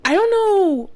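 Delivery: high, loud exasperated cry; real exasperation, high and loud. Real, not acted. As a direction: throw up your hands in disbelief. Exasperated outburst after quote.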